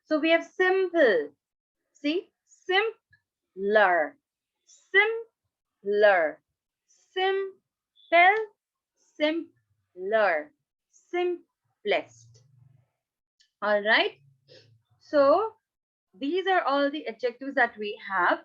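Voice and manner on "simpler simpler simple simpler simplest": "simpler" very slowly as simp, pause, ler; broken into syllables. Shown as a demonstration. Teacher showing two syllables, each word pulled apart. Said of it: "adjectives with two syllables".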